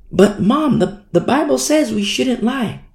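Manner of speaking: put-on high-pitched child protesting to a parent; imitated daughter. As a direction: play a little girl questioning mom. Daughter questioning mom, acted.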